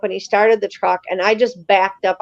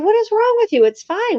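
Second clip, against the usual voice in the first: higher-pitched retold protest; dismissive retort; 12 semitones higher than usual. Friend brushing off her urge to leave, dismissive.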